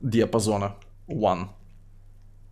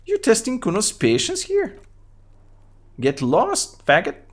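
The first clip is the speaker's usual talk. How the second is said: put-on high-pitched mouthy kid voice; Kuno voice; 10 semitones higher, 3 dB louder than usual. Kid Kuno mocks the cop, high bratty kid voice. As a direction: voice a rude little brat up high, talking about himself in third person. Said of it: "What is wrong with your high voice?".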